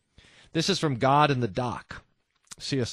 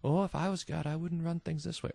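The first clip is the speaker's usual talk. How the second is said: mock know-it-all; 4 semitones higher, 6 dB quieter than usual. Mocking the atheist's view.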